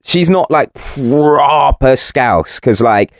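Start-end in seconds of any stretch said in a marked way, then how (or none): 0.7-2.0 "proper" hugely drawn out with a rolled start; long, relished "proper"; emphatic PROPER. Shown as a demonstration. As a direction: stretch out the word "proper" for emphasis. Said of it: "what I mean by proper Scouse".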